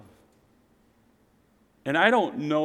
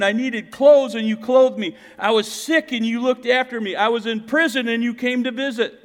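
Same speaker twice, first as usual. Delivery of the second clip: loud, high, emphatic preaching; preaching scripture; 8 semitones higher, 5 dB louder than usual. Acted. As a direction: proclaim each line to the congregation. Preacher reading scripture at full force.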